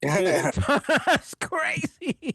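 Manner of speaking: high, very slow stretched "crazy"; amused sing-song crazy; drawn-out crazy. Real, not acted.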